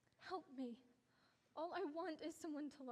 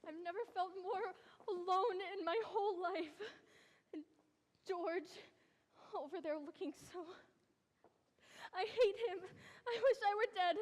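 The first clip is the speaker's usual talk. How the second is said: crying out louder and louder, near sobs; tearful panic; 6 dB louder than usual. Acted. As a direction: break down in despair before the wedding. Bride panicking before wedding.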